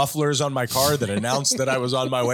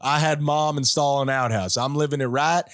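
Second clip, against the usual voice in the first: put-on Southern accent. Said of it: "It's not a terrible Southern accent".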